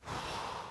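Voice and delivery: demonstrated heavy sigh. Shown as a demonstration. Israelites sighing under bondage, demonstrated sigh. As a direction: let out an unvoiced breath. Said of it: "notice they're sighing".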